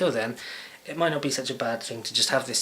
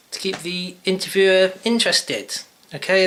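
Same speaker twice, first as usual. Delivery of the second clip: exaggerated intonation; 9 semitones higher, 8 dB louder than usual. Shown as a demonstration. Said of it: "You have to do intonation".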